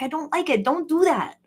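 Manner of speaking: a lightly voiced worried plea; uneasy protest. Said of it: "I was like".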